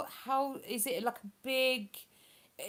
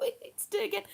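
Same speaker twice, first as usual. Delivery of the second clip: high voice giggling through the words; amused, high-pitched and no longer shouting; laughing repeat; 10 semitones higher, much faster than usual. Real, not acted. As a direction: repeat it while breaking up laughing.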